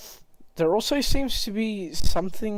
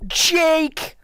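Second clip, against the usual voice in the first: high shout; 12 semitones higher than usual. Acted. NPC yelling a name.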